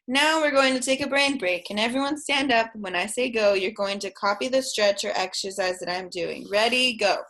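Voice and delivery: rally the little ones to stand up; kindergarten teacher voice. Pretend. Leads pretend class in brain break.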